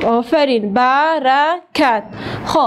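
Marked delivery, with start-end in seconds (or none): 0.7-2.0 برکت drawn out slowly, ba-ra-kat; slow syllable demo. Shown as a demonstration. Teacher slowly sounding out برکت for pupils. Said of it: "برکت چند صدا داره؟".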